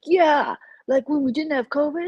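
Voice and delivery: mocking Cody. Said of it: "he's just like".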